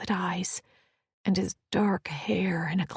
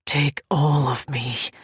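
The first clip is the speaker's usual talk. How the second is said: commanding male voice; 3 semitones lower, 6 dB louder than usual. Acted. Kent commands, slow.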